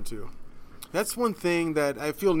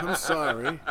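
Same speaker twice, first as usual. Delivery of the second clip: usual speaking voice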